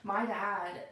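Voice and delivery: usual speaking voice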